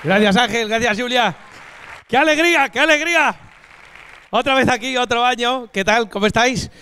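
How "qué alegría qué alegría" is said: high, bright cry of joy; joyful greeting. Real, not acted. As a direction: greet the crowd with delight. Comic greets audience joyfully.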